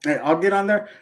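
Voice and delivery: normal talking voice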